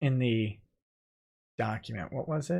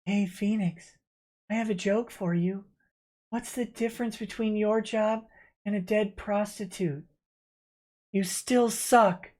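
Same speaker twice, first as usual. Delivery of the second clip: high, soft put-on Maya voice, dragging the punchline; 6 semitones higher, 5 dB quieter than usual. Reading a viewer's joke to Phoenix in the Maya voice. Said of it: "in my Maya voice".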